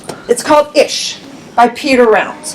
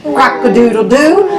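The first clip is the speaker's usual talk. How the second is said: usual speaking voice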